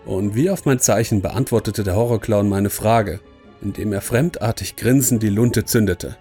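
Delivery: usual speaking voice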